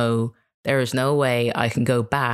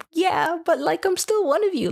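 much higher, protesting to the locals; pleading high voice. Retold or imitated. Telling locals in Kenya she still belongs, in a much higher pleading voice. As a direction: squeak it out like an outsider pleading to belong. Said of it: "I'm like".

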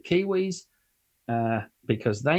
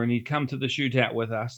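ordinary talk

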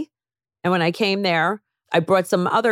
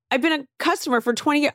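high, fast indignant protest; mock outrage; 10 semitones higher, faster than usual. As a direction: rattle it off in hurt disbelief. Freaking out at tailor.

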